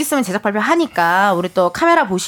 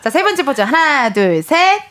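normal talking voice

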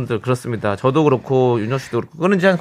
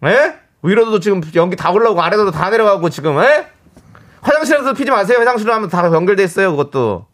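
higher-pitched calling out to a neighbor; loud mock scolding; 5 semitones higher than usual. Radio host yelling at the smoking neighbor upstairs, loud mock scolding. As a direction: holler a complaint upstairs on a listener's behalf. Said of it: "큰 소리 이야기해 주세요".

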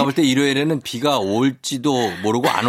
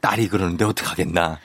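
lightly voiced old father; 7 semitones lower, 3 dB quieter than usual. Father boss excusing himself.

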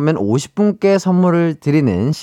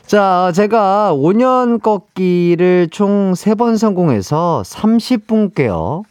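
ordinary delivery

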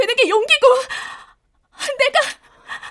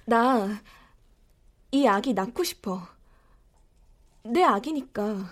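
much lower and softer, slow; quiet resolve; 11 semitones lower, 7 dB quieter, slower than usual. Acted. Daughter tells her mother she wants to keep the baby, quiet resolve. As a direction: state it softly but firmly.

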